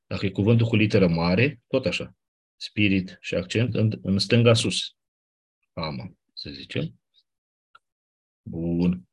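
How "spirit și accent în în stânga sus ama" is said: normal talking voice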